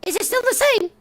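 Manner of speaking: chipmunk-high helium voice; high-pitched voice changer. Real, not acted. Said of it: "That's even worse".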